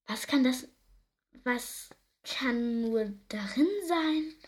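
ordinary delivery

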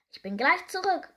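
high, loud parting call; bright-voiced storybook shout. A narrator's reading. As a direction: call it while dashing off. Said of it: "rief Seepferdchen".

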